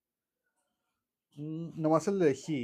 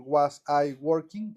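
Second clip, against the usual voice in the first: "Was I working?" said slowly, each word apart; slow separate words; 4 dB louder, much slower than usual. Shown as a demonstration. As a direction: pause between "Was" and "I". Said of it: "como que júntenla".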